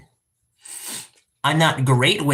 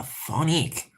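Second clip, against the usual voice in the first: tentative attempt; 7 dB quieter than usual. Real, not acted. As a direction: try the word out quietly. Tentatively tries the French word.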